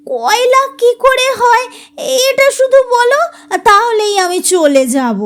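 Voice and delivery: high coaxing plea; pleading. Acted. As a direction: beg sweetly, voice lifted. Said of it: "Runa কাকুতি করলে". (মিনতি করে অনুরোধ)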